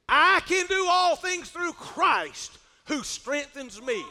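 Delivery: ringing scripture declamation. Acted. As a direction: proclaim the verse, stretching the key words. Declaims a Bible verse.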